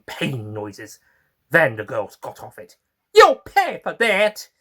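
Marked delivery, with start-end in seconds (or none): 3.1-4.5 slow, high, loud vengeful threat; cursing flytrap. Acted. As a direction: spit out revenge like a wounded beast. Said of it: "the flytrap cursed".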